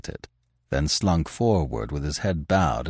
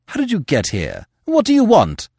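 sharp demand, jumping high and loud; 11 semitones higher, 5 dB louder than usual. A narrator's reading. Editor challenges the armed intruder, demanding. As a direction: demand it, pitch leaping on the second question. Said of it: "demanded the editor".

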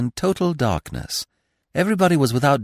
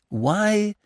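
eager question; 12 semitones higher than usual. A narrator's reading.

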